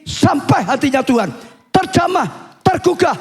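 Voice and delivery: high, emphatic sermon delivery; impassioned preaching. Acted. Preacher tells congregation their cries reach God's heart, impassioned preaching. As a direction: drive each repeated phrase home with rising fervor.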